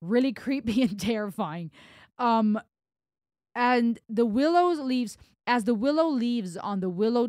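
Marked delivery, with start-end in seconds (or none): none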